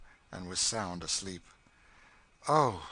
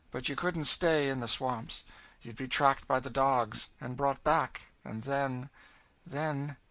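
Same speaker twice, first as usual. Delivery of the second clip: raised, forceful, somewhat higher voice; grim warning woman; 5 semitones higher, 5 dB louder than usual. Acted. Cassie warning the girl about the dogs, grim warning. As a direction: warn her grimly, pressing each point.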